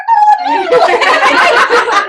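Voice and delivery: high, loud squealing laugh; shrieking laugh. Real, not acted. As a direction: burst out squealing with glee. Wakes husband with news.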